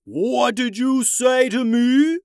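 slow, booming furious roar; roaring tyrant king. A narrator's reading. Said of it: "the king roared".

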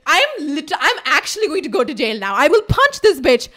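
hammed-up angry outburst; mock fury. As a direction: threaten your friend, loud and emphatic. Retold threat to her friend.

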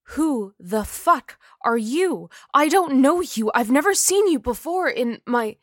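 high, loud furious retort; angry. Acted. Jennifer's ghost angrily rejecting Adrian, loud and high. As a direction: spit it at him, rising anger.

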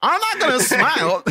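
high, drawn-out comic refusal; mock indignant protest. Worker refusing dad's demand for enthusiasm, mock indignant. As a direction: play up the put-upon refusal.

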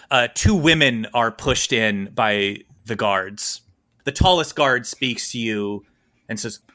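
ordinary talk, nothing marked

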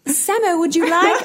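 high, laughing British nanny; a dog owner in a British nanny voice, laughing through it. Pretend. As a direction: put on a sing-song British nanny, cracking up. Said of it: "Do the voice".

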